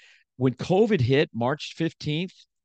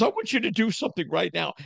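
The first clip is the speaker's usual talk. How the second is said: wife's command; 8 semitones higher, faster than usual. Retold or imitated. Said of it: "She goes".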